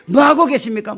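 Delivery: mock-furious pleading that builds in volume; angry complaint. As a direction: act out an indignant rant at God, starting restrained and rising to loud. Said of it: "하나님께 막 화를 막 냈어요".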